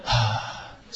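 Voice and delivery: quick soft puff on the out-breath; short soft exhale. Shown as a demonstration. Teacher showing short breaths between inhales, softly. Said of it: "今度は短い音で".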